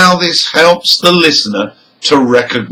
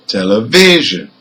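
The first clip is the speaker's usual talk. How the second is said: "television" stressed on VI; penultimate stress; 4 semitones lower than usual. Shown as a demonstration. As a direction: hit the third syllable of "television". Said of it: "second syllable from the end".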